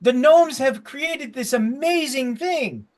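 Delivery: high-pitched gnome sales pitch; eager gnome. Acted. Gnome shows off gnomes' invention, high eager voice.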